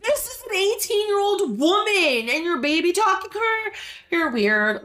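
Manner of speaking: shrill high disbelief, squeaked up; incredulous. Real, not acted. Vlogger reacting to a dad baby-talking an adult, high incredulous squeal.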